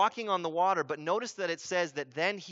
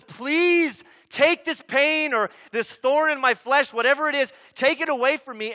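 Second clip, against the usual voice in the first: pleading; 8 semitones higher, 10 dB louder than usual. Retold or imitated. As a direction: beg forcefully, stretching the words. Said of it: "he pleaded".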